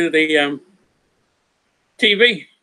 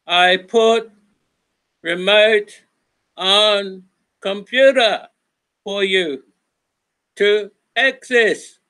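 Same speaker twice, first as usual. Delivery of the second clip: slow broken English; 4 semitones higher, much slower than usual. Retold or imitated. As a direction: drag every word out, slow. Mocking broken English slowly.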